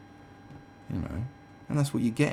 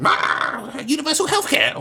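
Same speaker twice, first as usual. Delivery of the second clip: loud, drawn-out lunatic wail; cartoonish deranged cry; mock crazed outburst; 8 dB louder, slower than usual. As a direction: burst out like someone unhinged, hammed up. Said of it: "or something crazy like that".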